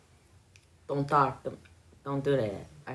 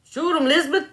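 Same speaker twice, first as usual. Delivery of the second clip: put-on much higher swamp hunter accent; Swamp People accent; 11 semitones higher, faster than usual. Mimicking a hunter telling Elizabeth to shoot, Swamp People accent. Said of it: "then I could mimic it".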